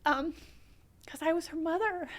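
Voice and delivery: very high, tearful voice; choked up. Real, not acted. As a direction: squeeze it out through real tears. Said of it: "I get choked up".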